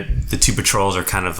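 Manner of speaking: normal talking voice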